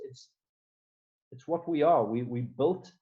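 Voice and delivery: ordinary delivery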